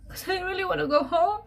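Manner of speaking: real crying; tearful. Real, not acted. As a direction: voice cracking high with longing. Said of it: "I'm just being emotional".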